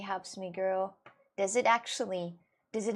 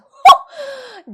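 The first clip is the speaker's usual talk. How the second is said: loud, high sudden hoot; dramatic outburst; 20 dB louder than usual. Acted. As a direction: burst out as if struck by the sun. Mimed reaction to sun appearing.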